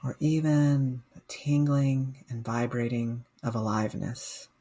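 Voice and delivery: slow, calm meditation guide with long pauses; guided meditation. Real, not acted. Mindfulness coach guiding listeners through a body scan, guided meditation. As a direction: guide listeners gently, leaving space after each phrase.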